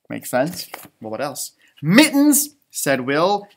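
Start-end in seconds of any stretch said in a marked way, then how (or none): 1.8-2.6 perky mittens. Acted. Will says mittens.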